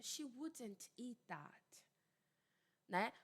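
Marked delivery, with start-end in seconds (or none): 0.0-1.6 slow, soft, near-whispered British WOULDN'T with D and T sounded; careful British accent, every consonant in WOULDN'T; British pronunciation. Shown as a demonstration. Teacher showing the other pronunciation, British accent. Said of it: "a pronúncia mais britânica".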